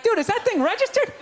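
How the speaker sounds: high, frantic hushed-urgent buddy; panicked friend. Retold or imitated. Friend in the entourage urgently warns the rapper, high and panicked. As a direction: warn him in a frantic high rush.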